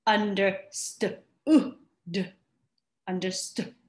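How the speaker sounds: teacher modelling a word; modelling understood. Shown as a demonstration. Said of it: "say this out loud with me".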